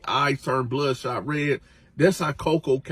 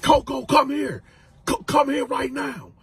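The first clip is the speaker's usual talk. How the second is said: high order; 11 semitones higher than usual. Retold or imitated. Freddy calling Coco again.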